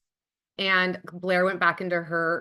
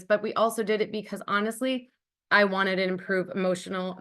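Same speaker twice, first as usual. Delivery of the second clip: ordinary talk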